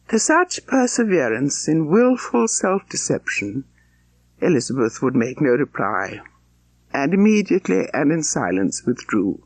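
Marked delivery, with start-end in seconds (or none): none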